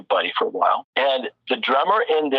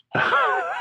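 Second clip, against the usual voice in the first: laugh. Real, not acted. Laughing at own story.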